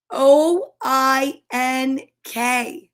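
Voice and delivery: very slow, letter by letter; spelling out. Acted. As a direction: spell it deliberately with gaps between letters. Spelling out the word for pig.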